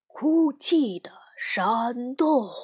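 slow, drawn-out spooky reading; spooky title. A narrator's reading. Sister reading the scary book cover, slow and spooky. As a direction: stretch the words out eerily. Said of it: "只见上面写着".

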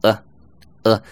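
"呃" as a bare uh, repeated separately; weak form. Shown as a demonstration. Teacher showing at without its t, weak vowel. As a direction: drop the t, leaving only a short "呃". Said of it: "后面的t都会省略掉".

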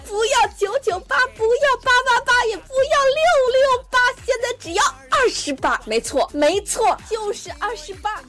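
hyped-up TV sales pitch; over eager infomercial. Pretend. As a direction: sell it like a late-night ad. Mock advert for renting men.

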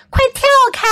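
very high, urgent warning call; storybook yell. A narrator's reading. Friends warn sledders of a snowman, high-pitched yell. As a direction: cry out a warning, pitched right up. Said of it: "琼和鲍勃喊道".

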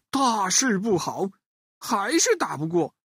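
usual speaking voice

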